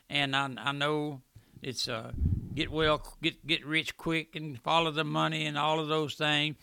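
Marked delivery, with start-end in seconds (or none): none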